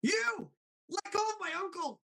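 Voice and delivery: very high, cracking cartoon cry; Dipper. Defending his uncle, high cartoon outcry. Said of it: "and then Dipper".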